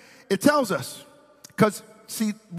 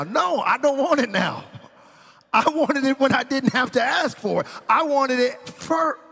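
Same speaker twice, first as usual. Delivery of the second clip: high, hammed-up indignation, slowing on the last line; indignant refusal; 8 semitones higher, 3 dB louder than usual. Wife scolds husband over food offered late, indignant. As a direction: protest in a raised pitch, drawing out the end.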